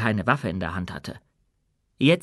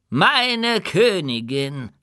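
put-on old dwarf voice; 6 semitones higher, 3 dB louder, much slower than usual. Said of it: "sagte er".

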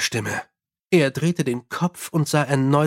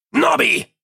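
a much higher, louder yell of a name; shouting; 10 semitones higher, 4 dB louder than usual. A narrator's reading. Mumm in the dark, shouting for Nobby. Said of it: "schrie Mumm".

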